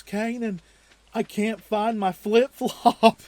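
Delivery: high, loud, slow whiny wail; a hurt boy crying over his lost flip-flop; imitating crying brother. Little brother crying to Kanan about his flip-flop, high, loud, slow whiny wail. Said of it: "He was crying 'cause he couldn't".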